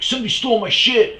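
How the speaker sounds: high, loud imitated snarl; mock angry yell. Stranger snapping at him, imitated as a high loud yell. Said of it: "And he's like".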